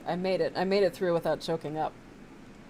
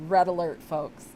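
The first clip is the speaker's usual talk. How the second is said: normal talking voice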